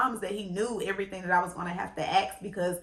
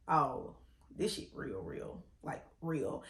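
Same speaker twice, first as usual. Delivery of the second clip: sinking much lower and softer into a breathy whisper; dawning dread; 8 semitones lower, 12 dB quieter, slower than usual. Retold or imitated. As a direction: realisation creeping in, voice fading. Sees surgical tools, panic.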